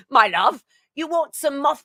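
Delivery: lightly imitated British accent. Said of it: "got that from Flock of Seagulls".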